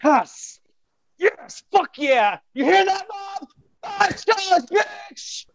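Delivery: whooping celebration shouts; triumphant yelling. Real, not acted. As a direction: scream in triumph at top pitch. Celebrating five-star rating.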